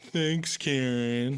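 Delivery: slow, drawn-out mock thanks; sarcastic jeer. Passenger blaming them for the emergency landing, sarcastic jeer. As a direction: drag it out, imitating a sneering passenger.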